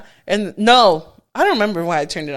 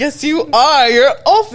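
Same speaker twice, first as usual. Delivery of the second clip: high, loud, laughing insistence; mock insistent argument; 6 semitones higher, 7 dB louder than usual. Woman insisting to Rob he's Opie, loud playful insistence. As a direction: argue back loudly through laughter. Acted quarrel over TV character.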